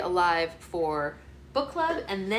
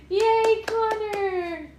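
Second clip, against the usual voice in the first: high, loud drawn-out cheer; 6 dB louder, much slower than usual. Real, not acted. Mother cheers along as her baby claps, cheering. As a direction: cheer big for a little one.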